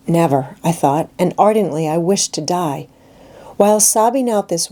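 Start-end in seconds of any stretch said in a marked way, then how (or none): none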